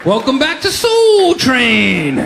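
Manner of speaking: slow, loud TV host welcome; game show host. Pretend. Comic hosting his own Soul Train, loud slow host voice. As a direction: boom it out like a hammed-up show host.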